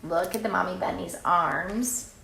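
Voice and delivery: usual speaking voice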